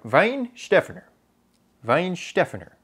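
Weinstephaner said VINE-SHTEF-en-er; German pronunciation. Shown as a demonstration. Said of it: "I think that's right".

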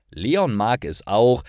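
usual speaking voice

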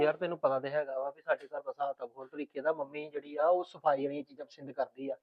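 husband to wife, quiet and confidential; hushed. Acted. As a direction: keep it low so mother won't hear. Husband quietly advises wife privately.

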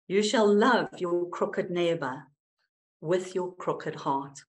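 hushed, deliberate recital of Auden; poem recital. Acted. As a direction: let each image land with pauses.

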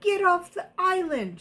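high-pitched angry shout. Acted. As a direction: yell it to drive them off. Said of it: "shouted Rupert".

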